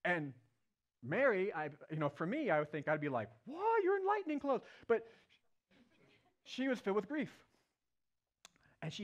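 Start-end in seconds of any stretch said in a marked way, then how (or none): 3.5-4.6 high-pitched mock-startled squawk; astonished. Preacher imagining his own reaction to angels, astonished. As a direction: act out being floored, voice leaping way up.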